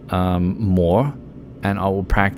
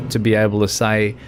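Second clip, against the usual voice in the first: ordinary delivery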